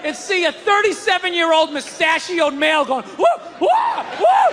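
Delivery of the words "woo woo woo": high, loud mock yelps; girlish scared whoops. Mustachioed man scared on plane, imitated girlish whoops. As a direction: imitate a grown man shrieking like a girl. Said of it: "that noise is acceptable out of a female".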